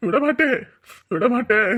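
loud angry oath; furious vow. Acted. Father vowing revenge on the in-laws, loud angry vow. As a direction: threaten them with full force.

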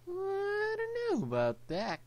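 very high, drawn-out squeaky doubt; sing-song falsetto, dragged out and loud; silly doubt. Pretend. Gamer doubts himself to viewers, silly high squeak.

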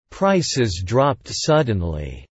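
slowed right down for learners; slow model reading. Shown as a demonstration. Teacher models the sentence slowly for learners. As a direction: read it slowly and clearly for the class. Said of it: "repetition drill, slow model take".

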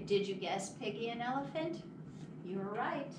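ordinary delivery, nothing marked

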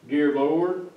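ordinary talk

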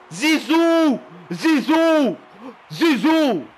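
high, repeated fan-like cry; loud, heated chant; shouted name. Real, not acted. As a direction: holler the name in separate bursts. Calling out name after the play.

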